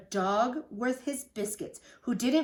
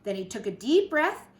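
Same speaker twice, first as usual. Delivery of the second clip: ordinary delivery, nothing marked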